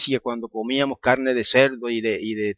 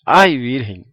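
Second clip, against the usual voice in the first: mock astonished cry, second one louder; retelling a habitual cry of surprise; astonished exclamation; 3 semitones higher, 4 dB louder, slower than usual. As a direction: exclaim in amazement, imitating his old habit. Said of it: "siempre diciendo".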